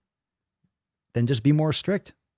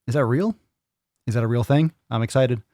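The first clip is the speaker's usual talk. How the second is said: usual speaking voice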